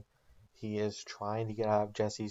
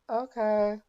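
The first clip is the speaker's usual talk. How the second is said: put-on much higher squeaky teen voice; kid's voice; 12 semitones higher, 7 dB louder than usual. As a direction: pitch way up like a scared boy. Teen son answers his dad.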